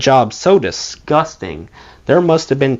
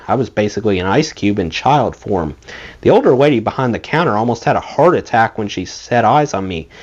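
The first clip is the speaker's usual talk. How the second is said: usual speaking voice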